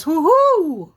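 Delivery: high, loud owl hoot; two-note storybook owl call. Acted. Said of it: "the owl says".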